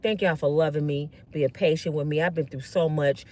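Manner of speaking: low, soft, subdued appeal; humble plea. Acted. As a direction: speak gently and low, asking for patience. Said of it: "This is what I would have said if I was her".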